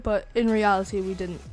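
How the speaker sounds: ordinary talk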